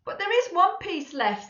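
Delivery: put-on high, helpful child voice. Laura to her mother, high and helpful. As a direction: chime in helpfully. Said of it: "in a very helpful way".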